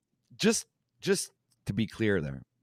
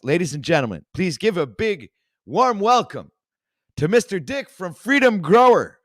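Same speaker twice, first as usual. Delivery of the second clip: booming higher announcer voice, louder; announcer intro; 7 semitones higher, 5 dB louder than usual. Acted. Host welcoming a guest to his stream, big announcer voice. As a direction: roll it out like a ring announcer.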